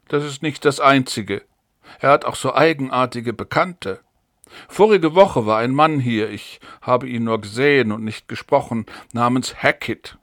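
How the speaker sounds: ordinary delivery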